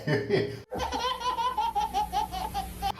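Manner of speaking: high mocking cackle; mocking laugh. Boss laughing at his employee, high mocking cackle.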